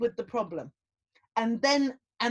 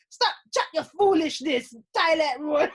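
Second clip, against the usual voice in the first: put-on a light Jamaican accent, higher and louder, dismissive; 6 semitones higher, 7 dB louder than usual. Rasta husband scolding his panicked wife, light Jamaican accent. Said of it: "he's a proper Rasta Jamaican".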